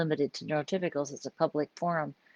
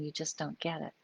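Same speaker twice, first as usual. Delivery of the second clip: quiet reproach; 9 dB quieter than usual. Retold or imitated. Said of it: "my wife is always saying".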